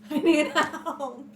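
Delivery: high, loud hammed-up cry; mock scream for help. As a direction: scream out for assistance, playfully. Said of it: "I will scream out".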